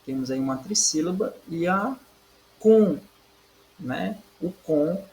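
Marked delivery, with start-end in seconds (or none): 2.6-3.0 "com" alone, high and loud; teacher singling out "com"; isolated stressed syllable. Shown as a demonstration. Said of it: "é a antepenúltima sílaba".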